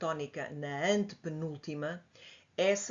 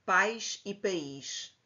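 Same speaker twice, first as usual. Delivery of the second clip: "Pais" as one syllable, "país" with stress on the "í", both slow; slow contrast; 2 semitones higher, much slower than usual. Shown as a demonstration. Teacher contrasting two words for students. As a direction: set "pais" against "país". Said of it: "é mais fácil se pensarmos na palavra também pais".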